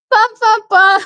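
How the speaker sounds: jittery high-pitched drumroll, caffeinated; too much caffeine. Real, not acted. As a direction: bounce it up high, wired on coffee. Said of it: "Had a bunch of coffee".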